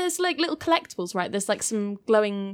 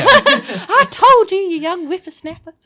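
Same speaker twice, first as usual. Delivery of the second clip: put-on very high, slow, loud old-timer with a laugh; hammed-up grumpy oldie scolding the youngster; old person voice; 11 semitones higher, 10 dB louder than usual. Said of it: "like, O A P impression there".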